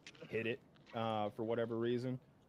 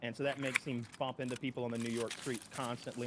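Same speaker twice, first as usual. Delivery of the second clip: normal talking voice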